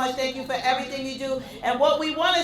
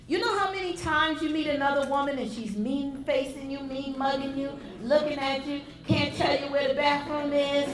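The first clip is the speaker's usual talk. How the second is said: normal talking voice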